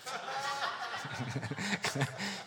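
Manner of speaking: quiet, breathy chuckle; soft airy laugh. Real, not acted. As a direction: laugh softly at your own joke. Laughs at own joke.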